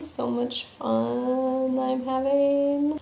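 slow, flat-voiced sarcasm; sarcastic deadpan. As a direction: drag it out slow and sour. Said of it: "stand off to the side like".

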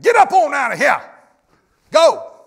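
loud, high, emphatic yell; shouted command. Acted. Preacher voicing God ordering the early church to scatter, emphatic shout. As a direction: yell to drive them out.

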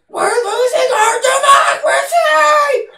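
high, loud, slow wailing shout; shrieking protester, overdone and mocking. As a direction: ham up a hysterical protester crying out. Mocking a protester meme moment.

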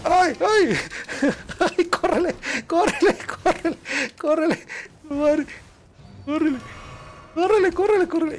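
very high shrieking run run; high squeaky panic cries; panicked squeal. Real, not acted. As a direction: squeal in real fright while fleeing. Chased by enemies, screaming to run.

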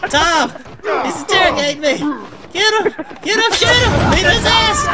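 high, loud panicked yelling with laughs; frantic yelling. Real, not acted. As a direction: yell for help, laughing in panic. Being interrogated, yelling for teammate.

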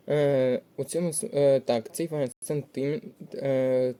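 normal talking voice

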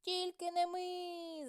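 high, slow squeaky mouse cry; lazy mice refusing, squeaky and high; mice shouting. A narrator's reading. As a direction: squeal it out, drawn out and stubborn. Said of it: "закричали мишенята".

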